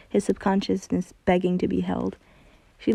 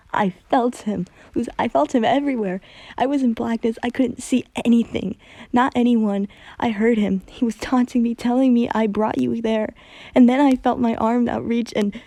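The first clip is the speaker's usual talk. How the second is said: louder, higher, rushing through it; panicked confession; 4 semitones higher, 6 dB louder than usual. A narrator's reading. Hunter telling Luz of the possession, panicked and loud. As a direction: spill out the horror, breath breaking.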